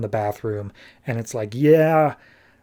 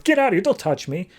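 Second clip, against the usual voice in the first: high-pitched retold outburst; mock freak-out; 6 semitones higher, faster than usual. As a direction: imitate someone suddenly freaking out. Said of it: "she just freaks out".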